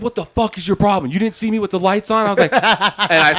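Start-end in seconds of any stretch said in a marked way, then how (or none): 0.0-2.3 raised, high-pitched scolding; indignant mock chewing-out; cop bitching someone out. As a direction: act out an irate cop's telling-off. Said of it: "so I let him bitch me out".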